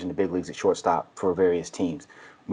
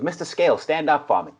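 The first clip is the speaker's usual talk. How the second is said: imitating a thick Boston accent, pitched higher; heavy Boston accent; 7 semitones higher than usual. Recruiting coach telling the player to stand, heavy Boston accent. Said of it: "real heavy Boston accent".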